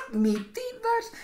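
"admitidas" squeaked very high and soft, slowly; mock squeal. Vlogger mocking words the academy accepted, squeaky and high. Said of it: "mocking squeal".

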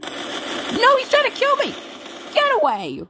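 high-pitched panicked protest; alarmed yelling. Real, not acted. Gamer being attacked by a character, alarmed yelling. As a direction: cry out as if under attack.

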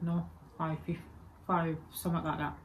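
ordinary talk

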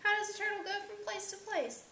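high, eager question; storybook cry of curiosity. A narrator's reading. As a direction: ask it pitched well up, keen to know. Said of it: "cried Hugo".

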